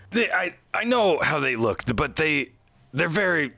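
lightly voiced, struggling to defend his crew; flustered Aldalar.

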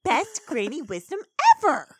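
high, loud, excited cheer; gushing pony. Retold or imitated. Pony praising granny's wisdom, gushing loudly. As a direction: build to a loud "ever".

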